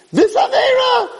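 very high, ringing call; emphatic calling out. Acted. Rabbi listing sins and their cleansers, high emphatic call. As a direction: cry out each item on the list. Preacher calling out each sin.

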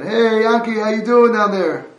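loud high shout down into a pit; calling down a pit. Retold or imitated. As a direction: yell down to the friend below. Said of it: "You scream down".

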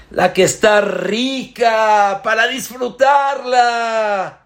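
rousing preacher's call; emphatic exclaim. Real, not acted. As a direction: throw the question to the crowd.

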